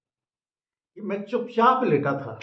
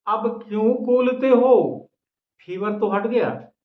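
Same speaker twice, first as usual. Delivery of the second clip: raised, higher and louder teasing question; emphatic wife; 5 semitones higher, 5 dB louder than usual. A narrator's reading. Wife teases her feverish husband, loud and emphatic. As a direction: throw it out big to the audience. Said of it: "वह धीरे से बोली".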